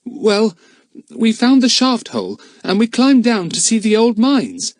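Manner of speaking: put-on boy explaining to the smugglers; boy's voice. A narrator's reading. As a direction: answer like an eager schoolboy. Philip explains to the men.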